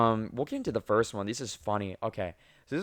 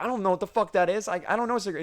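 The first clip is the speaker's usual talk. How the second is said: flustered; 9 semitones higher, faster than usual. Retold or imitated. Flustered new cashier panicking.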